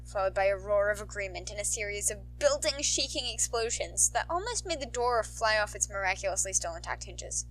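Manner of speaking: ordinary delivery, nothing marked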